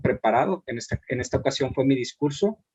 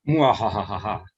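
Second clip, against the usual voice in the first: witch cackle; 5 semitones lower than usual. Acted. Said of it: "dijo la bruja". (risa malvada de bruja)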